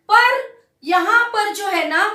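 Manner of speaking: usual speaking voice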